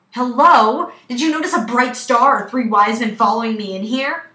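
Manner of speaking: loud, exasperated acted retort; sarcastic calling out. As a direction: call out to be heard, with sarcasm. Patient calling out sarcastically to staff.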